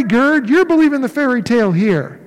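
ordinary delivery

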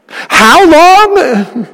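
a desperate how long lifted high; anguished cry. Acted. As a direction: cry it out to God, pained. Preacher cries the psalm's plea.

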